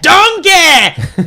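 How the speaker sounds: loud, high Scottish ogre holler; Shrek. Acted. Host trying a Scottish accent, hollered ogre impression. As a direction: holler it in a cartoon Scottish brogue. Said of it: "You can do a Shrek".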